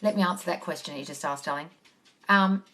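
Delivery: ordinary talk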